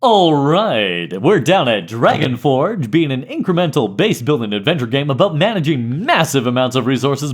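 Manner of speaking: big booming radio promo voice; radio announcer. Pretend. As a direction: sell it like a radio announcer, punching key words. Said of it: "Give me your best radio announcer voice".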